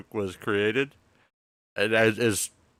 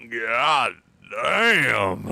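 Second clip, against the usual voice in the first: mock toilet exclamation.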